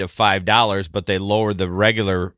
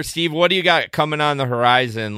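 ordinary talk, nothing marked

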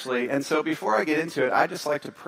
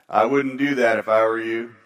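grim local warning; 6 semitones lower than usual. Retold or imitated. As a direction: warn him off like a weathered local. Old local gives warning.

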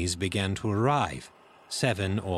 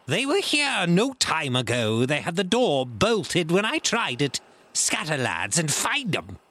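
high, agitated commanding voice; raging pirate; 8 semitones higher, 4 dB louder than usual. A narrator's reading. Blind man sending his lads searching, raised angry voice. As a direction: rail at them, then order the hunt.

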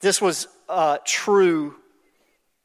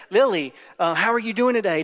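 bright, higher friendly teacher voice; teacher's greeting; 5 semitones higher, 4 dB louder, faster than usual. Retold or imitated. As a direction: greet a shy child cheerfully, voice lifted. Teacher greeting the shy girl.

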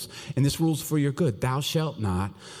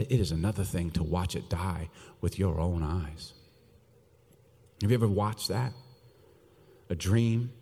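hushed; 6 semitones lower, 6 dB quieter than usual. Acted. Preacher lowering to a hush.

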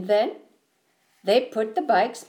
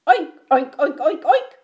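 high, loud oinks; a burst of short pig oinks; pig oinking; 6 semitones higher, 6 dB louder than usual. A narrator's reading. The pigs answer Duck's hello, oinking loudly. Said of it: "said Pig".

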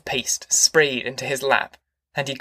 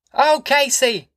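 high, carrying call across the yard; 11 semitones higher, 4 dB louder, slower than usual. A narrator's reading. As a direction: call him from the porch. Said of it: "he called".

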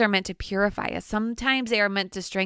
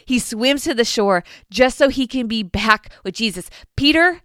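ordinary talk

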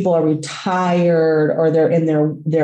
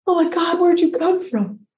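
high, overwhelmed wonder; retold astonishment; 10 semitones higher than usual. Dog owner to mysterious rescuer, retold astonishment. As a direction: act out stunned relief in a high voice.

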